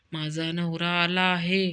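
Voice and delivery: ordinary talk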